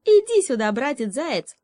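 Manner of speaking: high, loud call across the glade. Acted. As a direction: call the hare out of hiding. Frog calling out to hare. (громкий высокий зов)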